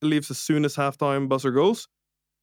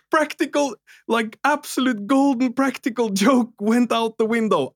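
laughing; 8 semitones higher than usual. Real, not acted. Laughing lament about missed joke.

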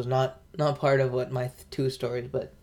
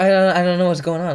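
raised, louder retold confusion; flustered bafflement; 5 semitones higher, 8 dB louder than usual. Retelling being lost at the parents' questions, flustered. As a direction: blurt it out, acting totally lost.

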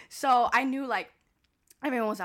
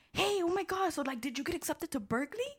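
mock-excited cousin on the phone, higher; 7 semitones higher than usual. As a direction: imitate a cousin eagerly asking for news. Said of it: "and he's all like".

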